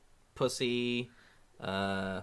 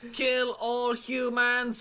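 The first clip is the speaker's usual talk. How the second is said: high, very slow robot voice; a slow, menacing machine; 8 semitones higher, much slower than usual. Retold or imitated. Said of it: "deadpan robot comedian".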